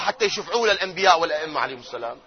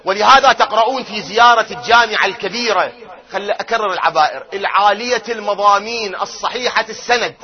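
ordinary talk

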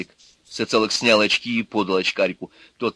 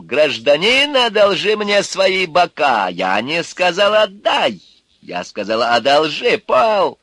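higher, louder contemptuous rant; sneering thug; 9 semitones higher, 6 dB louder, slower than usual. A narrator's reading. Urka mocking the apologizers, scornful rant. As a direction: spit scorn at the polite ones.

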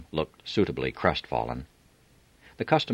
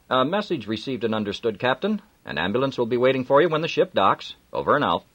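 official radio confirmation, higher; harbour master on radio; 9 semitones higher, 4 dB louder than usual. A narrator's reading. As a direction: reassure the captain briskly.

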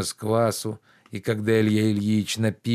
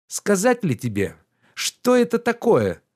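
higher, louder reproachful gentleman; lordly rebuke, pitched up and loud; indignant master Oblomov; 10 semitones higher, 4 dB louder than usual. Acted. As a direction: scold the servant with wounded pride.

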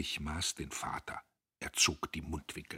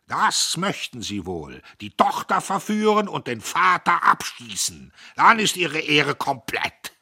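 shouting; 10 semitones higher, 13 dB louder than usual. Acted. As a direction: shout it in bitter rage. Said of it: "Göppel schrie". (Göppel schreit den Verführer an: bitterer Schrei)

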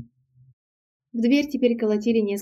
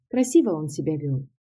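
ordinary delivery

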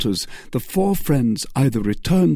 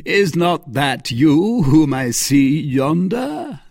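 slow raised call, higher; 7 semitones higher, 5 dB louder, slower than usual. A narrator's reading. Stranger on horseback hailing D'Artagnan, raised slow call. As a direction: shout it across the room, stretched out.

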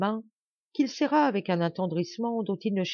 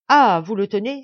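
loud eager cry, a bit higher; exclaiming; 4 semitones higher, 10 dB louder than usual. Acted. Balstain shouting to the soldiers over the prisoner, loud. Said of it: "s'écria-t-il".

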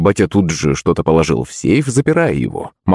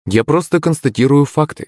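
ordinary delivery, nothing marked

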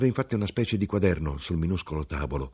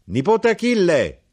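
high, loud call across the shop; 13 semitones higher, 8 dB louder than usual. A narrator's reading. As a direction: call the nephew from afar. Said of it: "chiamò". (chiamata ad alta voce, acuta e forte)

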